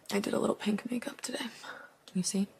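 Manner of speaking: hushed aside to the viewers; whisper. Real, not acted. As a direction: whisper it like a little secret. Confiding aside about makeup.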